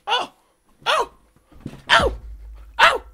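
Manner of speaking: short, high, loud "ow" cries; mock pained yelps. Mother getting kicked by her child, mimicked pained yelps. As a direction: imitate a mother yelping with each blow.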